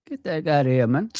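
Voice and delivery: ordinary talk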